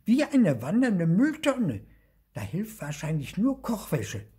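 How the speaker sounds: high, loud little ghost voice easing off; piping disgust. A narrator's reading. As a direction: exclaim in revulsion, then give a verdict. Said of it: "rief Lucy angewidert".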